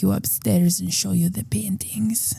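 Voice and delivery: put-on hushed Hungarian-accented seduction; old Hungarian man. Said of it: "an old Hungarian man".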